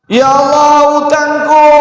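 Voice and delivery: high cry of Ya Allah, utangku; bad dying cry. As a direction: yelp it in panic. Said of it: "bengok-bengoke gak enak".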